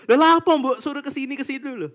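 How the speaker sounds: high, loud mock grumbling at God; hammed-up impolite protest; rude complaint, acted. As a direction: complain brazenly, starting loud and easing off. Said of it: "Kok kasar kayak gitu?".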